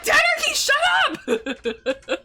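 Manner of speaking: high, loud laughing "shut up"; laughing mock yell. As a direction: yell at dad, half laughing. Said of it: "And I'm like".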